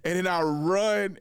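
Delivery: ordinary delivery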